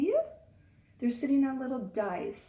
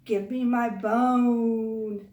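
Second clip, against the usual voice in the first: much louder, very slow, eerie drawn-out moan; louder ghostly voice; 8 dB louder, much slower than usual.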